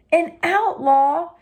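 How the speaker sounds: high, slow, aghast question; astonished high echo. Acted. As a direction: gasp out the word outlaw rising. Sidekick gasps at word.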